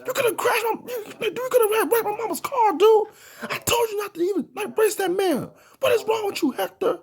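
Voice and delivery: very high, strained squeal of a panicking friend, half laughing; a hammed-up high voice for the furious, scared friend; frantic friend flipping out. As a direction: shriek it like your mama's car almost got wrecked. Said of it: "Samo started going off on everybody".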